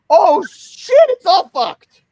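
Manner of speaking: sudden loud high panic; panicked. Retold or imitated. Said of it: "he's just like".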